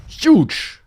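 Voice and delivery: huge said high and loud, falling long; emphatic announcement. Real, not acted. Debunking the myth, high loud drawn-out huge. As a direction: belt out huge like a verdict. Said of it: "I can confidently say".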